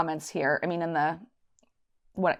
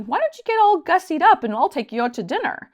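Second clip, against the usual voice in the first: higher, louder voice for her fiancé; sing-song invite, put on for him; imitating fiancé; 9 semitones higher, 6 dB louder than usual. Her fiancé inviting her to dinner, imitated lightly. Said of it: "he said to me".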